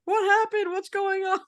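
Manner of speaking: high, loud alarmed calling; alarmed shout. Retold or imitated. Family running down, alarmed.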